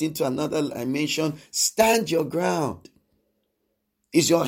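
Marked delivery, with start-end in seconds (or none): 1.5-2.9 very slow, every word weighed; slow emphatic command. Real, not acted. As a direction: lay each word down slowly and firmly. Preaching exhortation to congregation.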